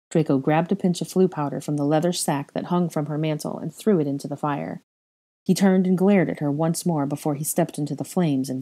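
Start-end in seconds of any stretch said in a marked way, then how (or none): none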